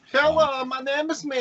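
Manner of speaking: put-on mysterious Eastern European accent, high. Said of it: "mysterious Eastern European accent you just used".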